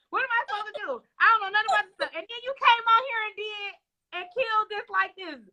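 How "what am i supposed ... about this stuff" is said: high, mock-helpless whine; imitating panicked guest. Host imitates the nervous guest, high mock whine. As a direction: play her fretting before the show. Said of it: "you was like".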